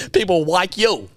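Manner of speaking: "People like you" high, laughing, with muddied consonants; mimicked speech impediment. Teasing friend's impression, "People like you" high and laughing. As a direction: tease "People like you" with a thick-tongued L. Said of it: "coinciding speech impediment".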